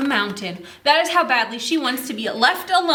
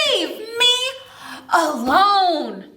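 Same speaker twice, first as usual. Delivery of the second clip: mild demo shout, higher on me; shout along; 6 semitones higher, slower than usual. Shown as a demonstration. Storyteller leading children in shouting the line, half-hearted. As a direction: call it out for the kids to join. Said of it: "Shout it as loud as you can".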